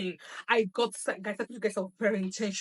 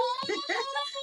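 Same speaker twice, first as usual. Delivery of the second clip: shrieking laugh. Real, not acted. Laughing at odogu joke.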